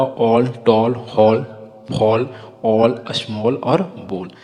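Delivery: slow, drawn-out word list; careful teacher drill of the double-l words; model words for repeating. Shown as a demonstration. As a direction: stretch the o sound in ऑल, टॉल, हॉल, फॉल. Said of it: "तो ये हमारा कॉल हुआ".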